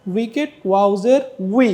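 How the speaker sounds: slow উই as the start of উইকেট; slow উইকেট then উই; like wicket. Shown as a demonstration. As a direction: model উই from the word উইকেট. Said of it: "আপনি খেয়াল করবেন উইকেট".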